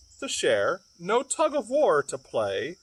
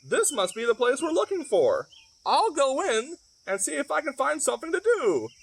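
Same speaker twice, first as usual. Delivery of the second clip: hen voice; 7 semitones higher than usual. Acted. Hen speaks in story.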